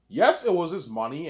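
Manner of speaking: loud, high, slow insistence; ranting hard about the money; emphatic. Real, not acted. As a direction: drive it home slowly and loudly. Emphatic rant.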